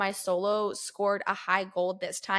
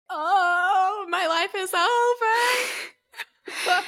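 hammed-up crying wail, very high and drawn out; mock wail; 11 semitones higher, 4 dB louder, much slower than usual. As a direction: wail it like a melodramatic teen. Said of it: "I was like".

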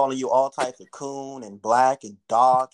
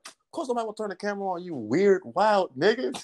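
retold exasperated remark; 7 semitones higher than usual. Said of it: "I said out loud".

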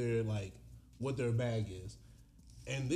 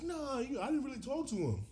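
high mock husband voice; 12 semitones higher than usual. Voicing the husband.

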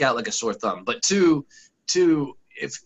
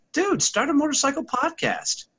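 mock-excited urging, pitched way up. Telling the HR guy to start a podcast, excitedly. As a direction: pump the guy up, high and eager.

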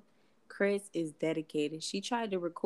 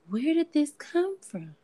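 mock dismay; 8 semitones higher, 6 dB louder than usual. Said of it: "And I'm like".

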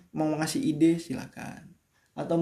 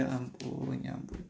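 much softer muttered dismay; quiet dismay; 12 dB quieter than usual. Real, not acted. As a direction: sigh it under your breath.

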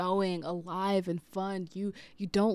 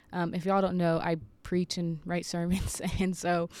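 ordinary talk, nothing marked

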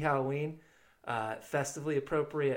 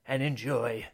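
spooky horror host. Acted. Spooky horror-host intro with music.

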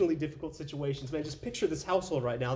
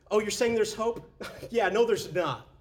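higher, louder, laughing scorn; mocking laugh; 6 semitones higher, 4 dB louder than usual. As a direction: laugh off the hope. Mourners mocking hope.

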